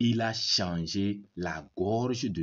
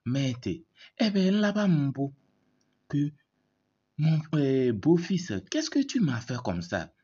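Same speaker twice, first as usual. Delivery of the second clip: high, loud pained outcry, hammed up; mock cry of pain; 7 semitones higher, 4 dB louder than usual. Mother-in-law cries out to her son-in-law, high and loud. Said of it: "elle crie et dit".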